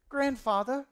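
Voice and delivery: high-pitched grandchild with a put-on accent; accented grandson. Grandson calling his grandfather, in a high put-on accent. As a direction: pipe up in a high, affected child's voice. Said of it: "I don't know why they have that accent".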